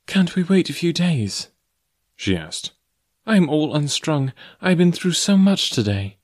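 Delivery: lightly voiced distressed heroine; pleading young woman. A narrator's reading. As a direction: beg for time, strained and weary. Jane pleading for delay.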